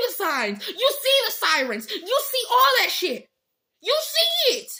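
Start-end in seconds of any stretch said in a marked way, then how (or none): 3.8-4.7 high shout. Real, not acted. Heated rant.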